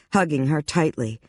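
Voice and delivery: plain reading voice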